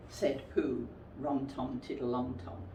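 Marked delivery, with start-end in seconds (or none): none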